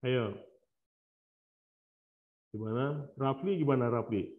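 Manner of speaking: faint, distant voice far from the mic; mic too far. Real, not acted. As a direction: sound far off the microphone. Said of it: "Suaranya kecil banget".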